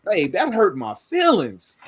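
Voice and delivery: FEELINGS pitched high, then falling; stress on FEELINGS. Shown as a demonstration. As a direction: run up to a big "feelings". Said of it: "but the inflection".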